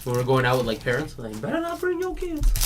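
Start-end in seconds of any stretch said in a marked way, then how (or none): 1.4-2.5 high, soft put-on voice; high put-on voice quoting a sketch; high mock Chappelle voice. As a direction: squeak it out like a sketch character. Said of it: "I still yell that sometimes".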